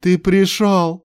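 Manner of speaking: high, drawn-out exclamation; overjoyed cry. Acted. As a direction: greet your rescuer with high, slow delight. Overjoyed friend greets his rescuer.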